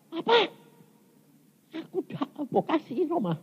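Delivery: high, hushed mock-tearful plea; tearful retold protest. Preacher re-enacts crying to God over having no house, tearful. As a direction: whimper it high and small. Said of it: "nangis saya".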